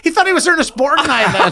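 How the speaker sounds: ordinary talk, nothing marked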